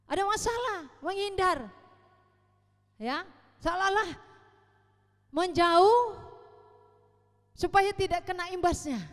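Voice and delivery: loud, driving pulpit delivery; preaching at full force. Acted. Preacher lists childish traits to the congregation, at full force. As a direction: preach it out to the whole hall.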